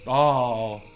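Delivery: startled oh. Real, not acted. Startled by blending mobs.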